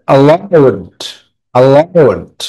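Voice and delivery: "allowed" with a light first syllable and stretched "-owed"; "a-LLOWED", second syllable stressed and drawn out. Shown as a demonstration. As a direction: lean on the "ow" in "allowed", then fall. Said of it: "আউট এর উপরে".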